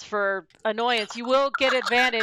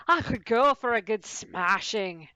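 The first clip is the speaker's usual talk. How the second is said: ordinary talk, nothing marked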